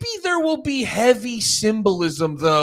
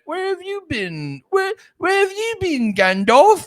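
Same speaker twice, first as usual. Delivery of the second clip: mock Frodo; 10 semitones higher than usual. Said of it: "Frodo's being like".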